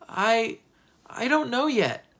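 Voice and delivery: put-on high, hesitant child's answer; narrated nervous stammer; flustered. A narrator's reading. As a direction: stumble on the first word, put on the spot.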